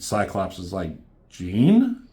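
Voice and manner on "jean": worried. Retold or imitated. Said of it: "Looking all worried".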